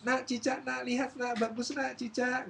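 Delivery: high sing-song coaxing; exaggerated nanny excitement; distracting a child. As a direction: lure the child's attention away. Maid distracting child with lizard.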